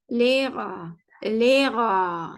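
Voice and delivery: "Lera" with long e, silent H; German pronunciation demo. Shown as a demonstration. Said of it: "Bu şekil".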